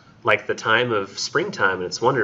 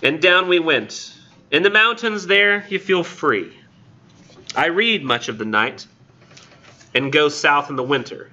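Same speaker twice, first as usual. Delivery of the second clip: poem reading; 6 semitones higher than usual. Acted. Lecturer resuming the poem for the class, poem reading. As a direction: recite it in spaced verse phrases.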